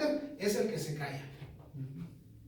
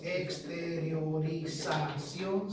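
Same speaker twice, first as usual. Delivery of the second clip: "exteriorización" drawn out slowly, syllable by syllable; slow, spelled-out "exteriorización"; spelling dictation; 3 semitones lower, 4 dB quieter, much slower than usual. Shown as a demonstration. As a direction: dictate "exteriorización" very slowly for note-takers, pitch kept low. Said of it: "con X".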